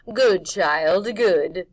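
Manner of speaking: loud, crooning crone; witch voice. Acted. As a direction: coax him like a pleased witch. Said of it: "she said".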